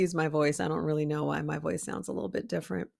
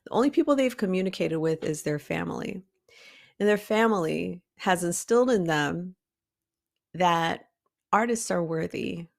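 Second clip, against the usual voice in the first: normal talking voice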